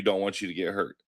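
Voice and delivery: normal talking voice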